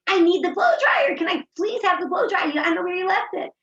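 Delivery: high, loud shout for the blow dryer; yelling. Retold or imitated. Daughter shouting to her ignoring sister, high and loud. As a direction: yell it up high, demanding. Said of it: "yelling to the one downstairs".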